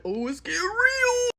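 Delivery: high, drawn-out rising squeal; slow, scandalized delight; gleeful onlooker reaction. Retold or imitated. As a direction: stretch it out as pitch climbs.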